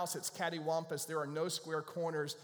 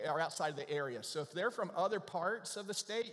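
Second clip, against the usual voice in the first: normal talking voice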